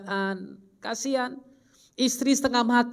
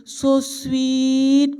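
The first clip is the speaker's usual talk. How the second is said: mock swooning wife; 3 semitones higher, 4 dB louder than usual. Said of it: "kau bilang".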